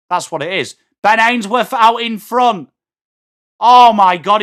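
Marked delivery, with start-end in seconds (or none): 3.6-4.4 much louder sudden exclamation; real loud surprise; shocked cry. Real, not acted. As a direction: blurt it out in disbelief. Player slips clear on goal.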